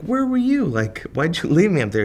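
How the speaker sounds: high, loud complaint; comic blames the booker, loud and high; mock indignant shout. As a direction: throw it back at him, outraged. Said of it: "I'm like".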